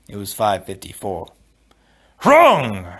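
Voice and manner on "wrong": loud, higher, commanding cry; ringing shout. A narrator's reading. Wesley cuts the prince off, ringing and loud. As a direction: let it ring across the room. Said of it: "Wesley's voice rang across the room".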